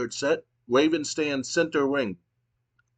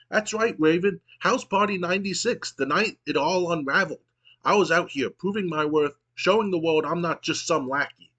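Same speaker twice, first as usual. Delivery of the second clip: high, excited lackey voice; frantic and high, over-eager; 4 semitones higher than usual. Acted. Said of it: "in a high-pitched, frantic voice".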